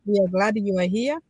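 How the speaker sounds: ordinary delivery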